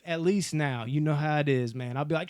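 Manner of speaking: usual speaking voice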